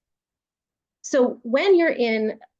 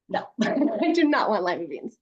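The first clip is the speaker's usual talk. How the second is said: ordinary talk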